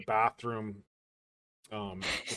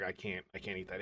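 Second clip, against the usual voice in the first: disgusted groan; 6 semitones lower, 13 dB quieter than usual. Retold or imitated. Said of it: "I was like".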